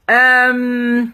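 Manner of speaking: ordinary talk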